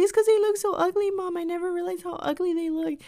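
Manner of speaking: high, rushed, whining complaint; hammed-up wail. Daughter explaining herself to mom, mock distraught wail.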